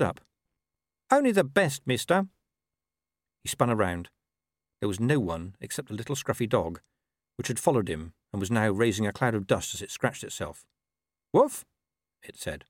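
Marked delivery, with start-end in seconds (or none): 1.1-2.3 high-pitched dog voice; talking dog. Acted. Dog warning the farmer. 11.3-11.6 single high spoken woof; talking dog. Acted. Dog feigning innocence.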